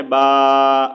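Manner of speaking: با held with the mouth fully open; open long baa. Shown as a demonstration. Teacher models the madd alif, long open با. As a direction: sound با straight forward, mouth wide. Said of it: "پورا منہ ہم نے کھول کے اس کو پڑھنا ہے".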